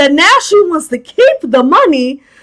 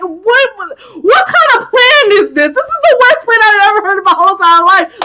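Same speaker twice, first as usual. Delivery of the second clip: can't believe how bad it is; exasperated disbelief; 6 semitones higher than usual. Real, not acted. Recapper ripping into the worst plan ever, exasperated.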